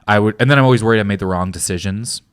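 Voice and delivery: usual speaking voice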